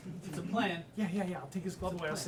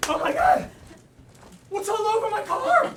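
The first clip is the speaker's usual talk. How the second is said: high, loud shocked cry; shocked shout; 12 semitones higher, 14 dB louder than usual. Acted. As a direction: yell out in disbelief. Finds car covered, shocked.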